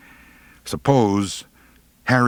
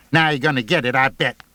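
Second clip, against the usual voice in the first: mean squeaky voice; 5 semitones higher, 3 dB louder, faster than usual. Acted. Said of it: "in her mean, squeaky little voice".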